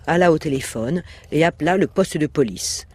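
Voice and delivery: plain reading voice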